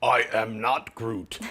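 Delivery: slow, deliberate mock Groot line; a lightly voiced Groot; Groot gag. Joking to the panel as Groot, slow and deliberate.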